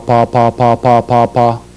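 even pa, pa, pa train; each "pa" with the same firm lip closure; repeated pa syllables. Shown as a demonstration. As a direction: repeat "pa" at a steady rate. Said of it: "Notice as I repeat this pattern".

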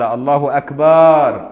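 أكبر drawn out loud as "akbaaar"; slow swelling last syllable on أكبر; lengthened ba. Shown as a demonstration. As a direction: hold the vowel after the b in أكبر. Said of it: "وعدم مد باء أكبر".

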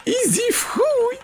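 very high, slow, stretched gloating; drawn-out high-pitched glee; gleeful squeal. Real, not acted. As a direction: squeal it slowly with delight. Gloating over lucky find.

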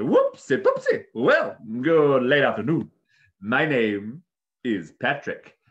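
loud, theatrical parrot greeting; jolly parrot Patrick. A narrator's reading. Parrot introducing himself to his new owner, jolly and loud. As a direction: burst in high, then proclaim grandly.

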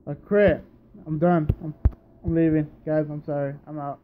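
usual speaking voice

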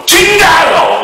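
high, loud, slow drawn-out yell; screeching involuntary curse; shouted swear. Pretend. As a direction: stretch the curse out at full volume. Mock tic outbursts of swearing.

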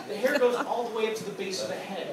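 normal talking voice

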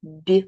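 «б» softened as a soft palatal b. Shown as a demonstration. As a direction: say «б» softly, palatalised. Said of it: "а якщо м'який". (м'який звук «б»)

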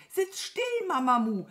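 very high, loud crow command; alarmed shout. A narrator's reading. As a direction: order it in a shrieked, urgent call. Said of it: "rief die Krähe". (schrill und laut befehlen)

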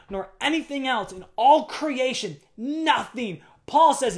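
loud, high, rising sermon climax; full-force preaching. Acted. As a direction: preach it with fire to the church.

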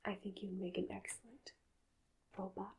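normal talking voice

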